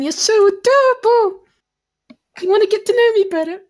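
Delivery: high, loud squeal of adoration; gushing admirer. Acted. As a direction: coo loudly in a very high, flirty voice. Playing a gushing character to Aki-chan.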